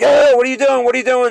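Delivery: high, loud alarmed yelling; retold scream. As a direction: yell it out in a panicked rush. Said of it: "I start screaming".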